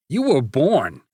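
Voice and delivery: loud snarling retort; snarled insult. Acted. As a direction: spit it with hate. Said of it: "snarled Nate".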